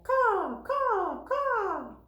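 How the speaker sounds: high, carrying crow caws. A narrator's reading. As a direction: caw like a crow. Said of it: "Crow calls out".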